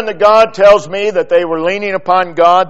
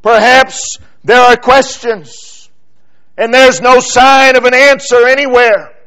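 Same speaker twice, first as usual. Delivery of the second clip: loud, higher, rising sermon climax with punched commands; full-force preaching; 7 semitones higher, 8 dB louder than usual. Acted. Preacher urging the congregation to pray without ceasing, at full preaching force. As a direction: preach it hard, hammering each "pray".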